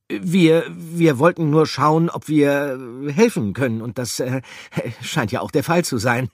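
high, loud, tripping over words; lightly flustered babble; babbling in fluster. Acted. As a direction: gabble nervously with hesitations.

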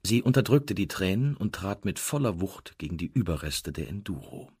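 usual speaking voice

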